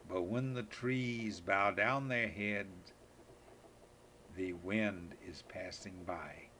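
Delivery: slow, hushed recital fading at line ends; gentle poem reading. Acted. Grandpa reading the wind poem to Megan, slow and hushed.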